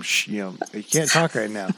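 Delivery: put-on soft, hushed warning to a child; hushing quietly. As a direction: keep it down so the mic won't catch it. Hushing son during radio show.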